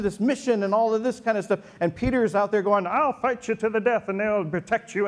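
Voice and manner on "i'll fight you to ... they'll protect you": higher, gung-ho voice of an eager disciple; lightly voiced brave boast, higher; a hint of a puffed-up fighter; eager Peter. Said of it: "His voice actually gets like that".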